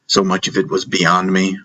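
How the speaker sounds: usual speaking voice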